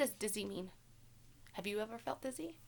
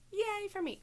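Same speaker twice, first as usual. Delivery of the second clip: bright, higher little cheer; small cheer; 9 semitones higher, 3 dB louder than usual. Real, not acted. Teacher cheering herself on camera, excited. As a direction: cheer for yourself after fixing the camera.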